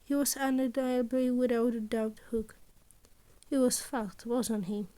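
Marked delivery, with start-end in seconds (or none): none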